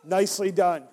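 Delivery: ordinary talk, nothing marked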